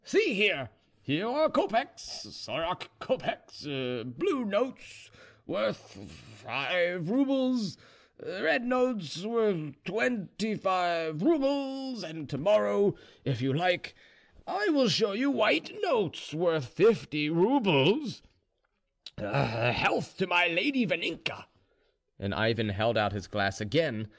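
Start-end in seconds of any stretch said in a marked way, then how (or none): 0.0-18.2 put-on slow, high-pitched drunken boasting; drunk Ivan. A narrator's reading. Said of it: "getting more and more drunk". 19.2-21.4 high-pitched tipsy toast; drunk Ivan. A narrator's reading. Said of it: "getting more and more drunk".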